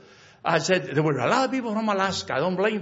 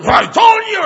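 much higher, much louder furious shout; angry shout; 11 dB louder than usual. Retold or imitated. Angry man at the pool yelling at the pastor, furious shout. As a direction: snap back in a rage. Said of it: "he was so mad".

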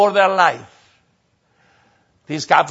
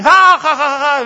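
laughing; 9 semitones higher, 7 dB louder than usual. Retold or imitated. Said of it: "everybody started laughing".

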